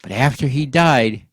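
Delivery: ordinary delivery, nothing marked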